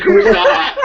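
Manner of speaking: high, loud "who's that"; mock surprised. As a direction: squeak it out in shocked disbelief. River confused by Missy.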